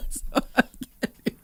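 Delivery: high, loud, laughing through words; can barely speak for laughing; laughing hard. Real, not acted. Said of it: "'cause I'm laughing too hard now".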